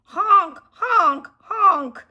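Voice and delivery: loud high honks like a goose; goose honking. Acted. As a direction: call out like wild geese. Geese flying south.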